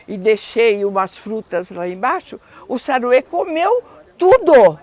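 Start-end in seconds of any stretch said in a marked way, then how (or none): 2.7-4.8 high and loud, half laughing; indignant exclamation. Real, not acted. Telling visitors the opossum ate all the fruit, exclaiming. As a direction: burst out with the punchline.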